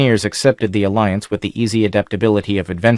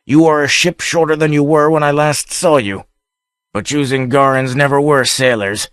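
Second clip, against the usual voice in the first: taunting; 5 semitones higher than usual. A narrator's reading. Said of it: "he taunted".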